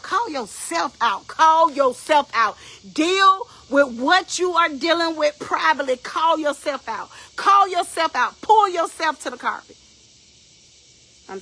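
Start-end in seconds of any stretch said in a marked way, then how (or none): 0.0-9.8 high, loud preaching, drawing out the commands; forceful exhortation. Real, not acted. Preacher urging listeners to call themselves out, forceful. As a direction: drive it home at full preaching force.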